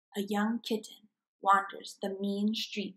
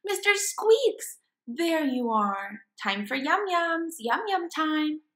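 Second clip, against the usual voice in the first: higher, slow sing-song coo to a pet; cooing baby talk; 7 semitones higher, 4 dB louder, slower than usual. Acted. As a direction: fuss over the hamster at feeding time.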